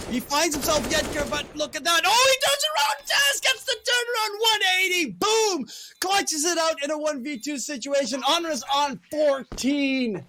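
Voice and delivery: rising hype into a shout; excited shout. Real, not acted. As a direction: yell the turnaround. Caster hypes a clutch turnaround.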